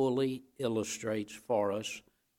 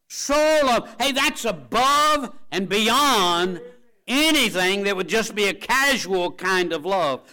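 loud, driving preacher's cry; preaching at full force; 8 semitones higher, 8 dB louder than usual. Acted. Preacher rousing his congregation, loud and fervent. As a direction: preach it hard over the congregation.

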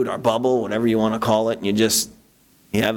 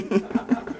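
breathy laugh; 8 semitones higher, 5 dB quieter than usual. Real, not acted. Laughs after joke.

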